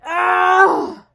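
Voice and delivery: loud strained cry of effort; pained cry. Retold or imitated. Recruit straining in push-up position, pained loud cry. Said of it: "and you're like".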